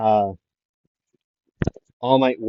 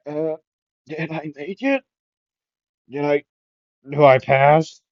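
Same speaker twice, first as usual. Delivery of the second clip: higher, strained put-on voice; battered fighter's voice; 5 semitones higher, 4 dB louder than usual. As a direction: ask as if battered after a fight. Battered, bloodied student asks after collapsing.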